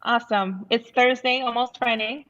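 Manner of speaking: normal talking voice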